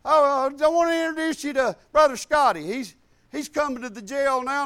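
lightly mock the prisoner's retort; mock inmate voice. The angry prisoner snaps back at the preachers, mocking. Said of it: "he turns and he says".

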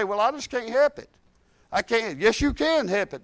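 ordinary talk

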